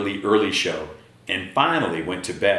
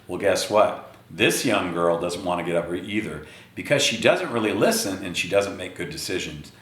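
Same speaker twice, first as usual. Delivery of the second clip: ordinary delivery, nothing marked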